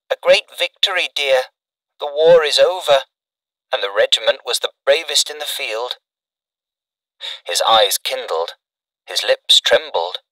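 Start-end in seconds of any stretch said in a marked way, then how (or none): 0.1-6.0 lightly voiced mother, higher; a hint of a proud mother comforting her son; reassuring mother. A narrator's reading.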